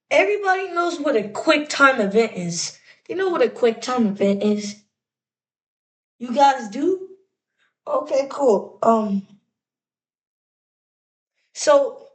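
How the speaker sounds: ordinary talk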